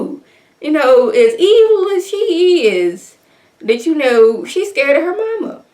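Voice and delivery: high, loud falsetto of disbelief; high stunned who-knew squeal; amazed. Real, not acted. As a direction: squeal it like you can't believe it. Surprised First Lady fears mom.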